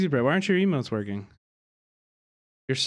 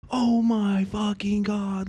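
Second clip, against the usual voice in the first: amazed; 7 semitones higher, much slower than usual. Real, not acted. Stunned by the new setup.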